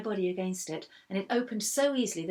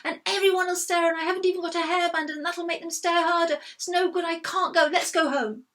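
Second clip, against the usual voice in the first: high, loud tearful wail; shouting and crying; 11 semitones higher, 9 dB louder than usual. A narrator's reading. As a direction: sob and shout at once. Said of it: "shouting and crying at the same time".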